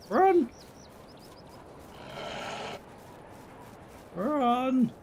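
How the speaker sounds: drawn-out, high-pitched "run"; urgent cry. Pretend. Fleeing wolves in the game.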